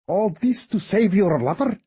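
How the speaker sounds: mocking. A narrator's reading. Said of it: "He laughed out loud".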